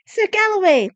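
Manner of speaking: high, loud call. A narrator's reading. Desmond runs over to stop the knight, high shout.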